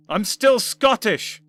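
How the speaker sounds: high, loud, slow disbelief; incredulous cry. Acted. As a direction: cry out in shock. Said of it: "he cried incredulously".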